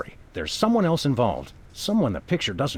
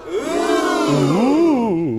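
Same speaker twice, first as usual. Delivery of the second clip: mock impressed ooh; 7 semitones higher, 6 dB louder than usual. [name] mocks Myra's threat.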